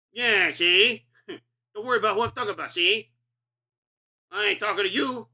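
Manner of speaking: put-on loud, high 1930s gangster accent with a tag of see; tough-guy gangster taunting the coppers, gangster accent; hammed-up old-movie gangster accent, punching each see; James Cagney accent. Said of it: "James Cagney accent".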